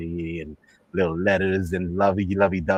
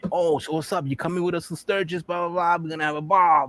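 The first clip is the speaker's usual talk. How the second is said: raised-pitch hyped-up buddy voice; light mock of a rowdy pal; biker friends; 8 semitones higher than usual. Friends invite the biker to Sturgis, hyped-up and high. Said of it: "his friends is telling him".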